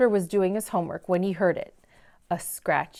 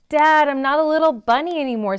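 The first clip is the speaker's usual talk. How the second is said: put-on insistent kid; 6 semitones higher, 5 dB louder than usual. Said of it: "said Jasper".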